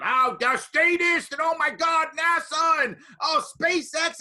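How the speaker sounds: high, loud mocking rant of angry activists; mock attack. Newcomer attacked by activists at a meeting, outraged mock rant. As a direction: ham up the activists' outraged squeal. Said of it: "they attacked him".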